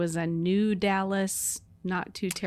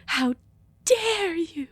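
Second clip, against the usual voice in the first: whisper yelling; 10 semitones higher, slower than usual. Pretend. Mock soap quarrel.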